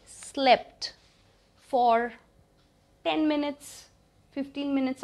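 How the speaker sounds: very drawn out, pausing between pieces; deliberate, spaced-out words; slow teaching model. Shown as a demonstration. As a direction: dictate it slowly for learners. Teacher models sentence slowly.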